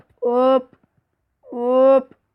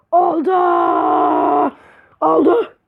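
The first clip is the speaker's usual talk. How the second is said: high, loud cry of success; triumphant shout; 5 semitones higher, 4 dB louder, much slower than usual. Real, not acted. Gamer finally succeeding, triumphant shout. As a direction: shout out the win.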